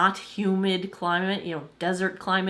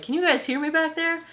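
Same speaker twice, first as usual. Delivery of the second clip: calling out; 10 semitones higher than usual. Retold or imitated. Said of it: "I'm like".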